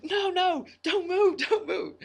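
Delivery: high, urgent yell of people calling down; mock yelling. Rescuers on the hilltop shouting down to the stuck climber, high urgent yell. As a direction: shout it up high, urgent, to stop someone moving. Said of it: "then they're like".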